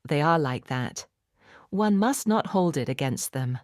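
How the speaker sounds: usual speaking voice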